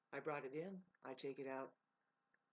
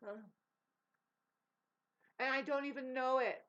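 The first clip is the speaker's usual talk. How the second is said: high, loud hammed-up dude voice; mocking macho dudes; 10 semitones higher, 9 dB louder, slower than usual. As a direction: squeal it like a cartoonish bro bragging about his truck. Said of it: "and they're like".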